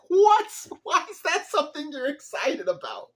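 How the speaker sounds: very high, loud disbelief; incredulous outburst. Real, not acted. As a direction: squeal it in sheer disbelief. Incredulous at the villain's glee.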